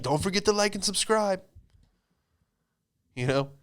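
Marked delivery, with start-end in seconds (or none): 0.0-1.4 high, chirpy youtuber outro voice; youtuber parody. Retold or imitated. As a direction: sell it like a cheesy youtuber signing off. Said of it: "isis would be like".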